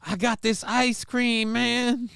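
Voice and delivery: a hammed-up high junkie; mock junkie. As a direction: stretch it out like a spaced-out thief. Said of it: "or, uh".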